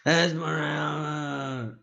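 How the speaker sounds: long drawn-out yell of a name; slow, high, stretched yell. Acted. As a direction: bellow the name across the distance. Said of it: "looks off into the distance with his dead eyes and yells".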